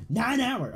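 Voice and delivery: NINE HOUR punched high and loud; emphatic repeat. Real, not acted. Streamer boasting of a live stream, "Nine hour" loud and high. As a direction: exclaim "Nine hour" with a big jump up.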